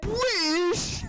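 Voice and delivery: squeaky rising mock astonishment; high-pitched meme impression. Retelling the British guy meme, high-pitched imitation. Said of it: "so high pitched at the end".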